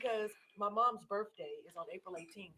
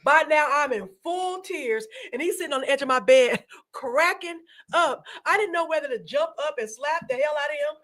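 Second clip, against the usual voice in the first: laughing retelling; 7 semitones higher than usual. Real, not acted.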